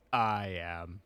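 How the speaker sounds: low, soft robotic drone. Retold or imitated. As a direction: power down like a robot. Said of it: "in a robot voice".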